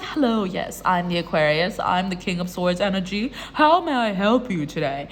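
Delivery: lightly played-up composed host, low-pitched; self-introduction in role. Aquarius greeting a new love interest, mock-formal. Voicing the listener greeting a date.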